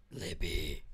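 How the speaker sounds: very low demonic growl of a name. Pretend. Streamer calls a friend's name in a demon voice. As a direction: drag the name up from the pit, deep and slow. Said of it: "In my demonic voice".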